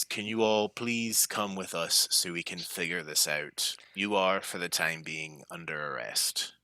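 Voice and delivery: a hint of an official guard, toned down. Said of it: "And then the guard says".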